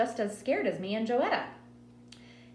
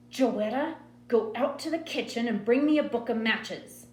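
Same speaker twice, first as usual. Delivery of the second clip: mother's order with a slight hiss; a hint of a menacing mother; strange, hissing mother; 4 semitones higher, 4 dB louder than usual. A narrator's reading. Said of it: "Mama's voice got strange, hissing like a snake".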